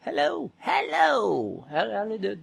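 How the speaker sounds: put-on quiet, slow little old lady voice; a hint of a frail old lady. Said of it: "I gotta do my old lady voice".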